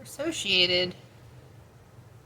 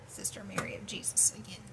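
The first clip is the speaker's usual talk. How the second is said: whispering; 9 dB quieter than usual. Real, not acted. Reading a search result hushed.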